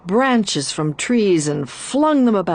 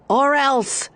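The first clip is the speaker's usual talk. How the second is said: shrieked threat; 7 semitones higher than usual. A narrator's reading. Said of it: "it shrieked".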